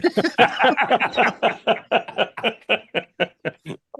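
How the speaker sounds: hearty laugh at own joke; rising real laugh; big laugh. Real, not acted. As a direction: burst out laughing.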